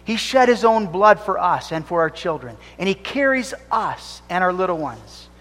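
ordinary talk, nothing marked

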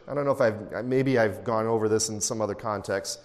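usual speaking voice

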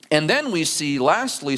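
ordinary talk

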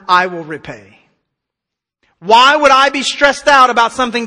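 loud, driving sermon voice, pressing every point; preaching at full force. Acted. Pastor urging the congregation to trust God, preaching hard and loud. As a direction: hammer it home to the congregation.

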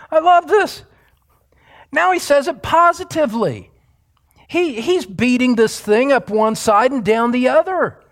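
high, delighted, emphatic sermon delivery; excited preaching. Real, not acted. Preacher marvels to congregation at the text, excited preaching. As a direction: preach it with mounting amazement, high-pitched then settling.

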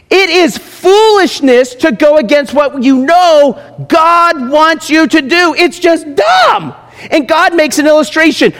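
high, slow, forceful pulpit voice; full-volume sermon delivery; preaching at full force. Real, not acted. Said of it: "preaching at full force".

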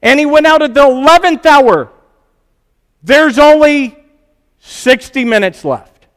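high, loud preaching build. Acted.

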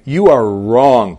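slow, loud "You are wrong"; weighty, deliberate "You are wrong"; emphatic. Acted. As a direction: stretch and press on "wrong". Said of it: "Emphatically saying".

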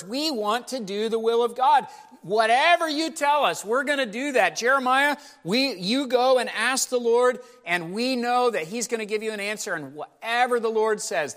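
high, loud gushing promises; overeager people. Acted. Preacher voicing the survivors promising Jeremiah, high and gushing. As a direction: sell the devotion a little too hard. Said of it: "They're saying all the right words".

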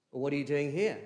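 HERE stressed with a falling close. Shown as a demonstration. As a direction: land the question on here. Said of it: "where the emphasis you could put".

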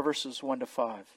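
usual speaking voice

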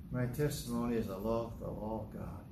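low, soft, half-hearted testimony; dutiful, lifeless mumble; unconvincing. Acted. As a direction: recite it as if made to stand up. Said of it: "Well, that's not very convincing".

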